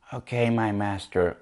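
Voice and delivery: low, hushed mock submission; lightly acted docile underling; mock obedient reply. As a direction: imitate a meek servant, quietly. Voices Anakin meekly obeying Palpatine.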